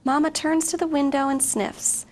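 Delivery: ordinary talk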